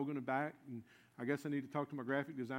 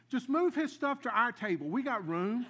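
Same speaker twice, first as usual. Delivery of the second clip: higher, louder call to the waitress; calling out an order; 7 semitones higher, 7 dB louder than usual. Retold or imitated. New friend stops the waitress to move the food, calling out. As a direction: call it across, taking charge.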